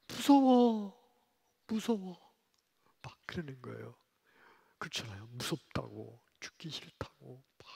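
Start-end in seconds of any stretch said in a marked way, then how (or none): 0.1-2.3 high, loud cry of fear; frightened cry. Retold or imitated. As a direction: cry out to father in panic. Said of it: "무서워서 떨면서". (아빠에게 무섭다고 외치는 딸)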